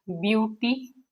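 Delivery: Beauty slowly, as two separate syllables. Shown as a demonstration. Teacher points out the first syllables to students, beauty slowly split in two. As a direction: leave a clear gap inside beauty. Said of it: "Let me tell you कैसे".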